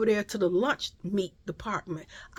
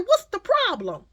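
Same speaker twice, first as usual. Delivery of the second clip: high, loud challenge; retold confrontation; 11 semitones higher, 5 dB louder than usual. Mother turning on the woman by her buggy, mock confrontational. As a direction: demand an answer, pitched up and loud.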